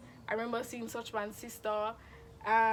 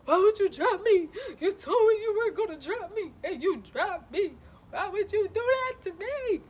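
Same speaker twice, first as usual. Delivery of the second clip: loud, high, hammed-up tearful wail; dramatic as hell; 9 semitones higher, 8 dB louder than usual. Dropped flyer wailing at the guy who dropped her, dramatic as hell. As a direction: overact the betrayal, wailing each accusation. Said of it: "I was being dramatic as hell".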